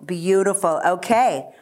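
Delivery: ordinary delivery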